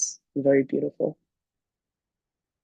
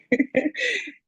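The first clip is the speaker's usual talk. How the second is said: a sudden high squeal of a laugh. Real, not acted. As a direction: laugh at your own story. Laughing at own retold story.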